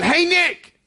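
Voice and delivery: high, loud call across the way; yelling out. Retold or imitated. Girl calling out to Nick from afar, loud high shout. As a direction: call his name from a distance, high and loud. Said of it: "who yells out".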